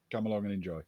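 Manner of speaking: ordinary talk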